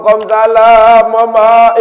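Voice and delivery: loud, drawn-out greeting; mock doting modern mum; sing-song mother.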